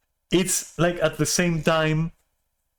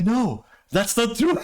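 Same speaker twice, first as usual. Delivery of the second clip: 1 mock earnest denial; 8 semitones higher than usual. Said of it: "And the owner goes like".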